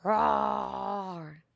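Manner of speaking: mild mock roar for the child; playful lion noise, lightly voiced. Shown as a demonstration. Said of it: "Can you make a lion noise?".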